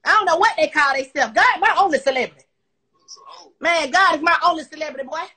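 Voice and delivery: loud, emphatic declaration; loud and defiant. Real, not acted. Telling followers God outranks celebrities, loud and defiant. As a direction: declare it forcefully at full voice.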